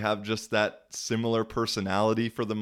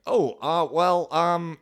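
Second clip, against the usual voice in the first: higher, louder stammering "oh, uh, well"; flustered backtracking; 8 semitones higher, 6 dB louder, slower than usual. Retold or imitated. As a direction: scramble to backpedal. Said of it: "totally backtracking".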